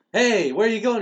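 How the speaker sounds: loud, high acted challenge; mock calling out. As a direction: call after someone leaving, hammed up. Uncle calls after departing kid.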